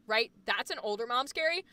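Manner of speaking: ordinary talk, nothing marked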